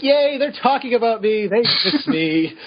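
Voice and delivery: high, gleeful imitation; hammed-up delight, high and stretched; mock childlike glee. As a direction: cheer in a high put-on voice, drawing out the end. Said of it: "He's just spinning around and like".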